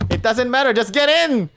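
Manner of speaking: high, loud urgent command; urgent order. Acted. Wolf shoving narrator into the chest, urgent. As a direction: push them to hurry, loudly.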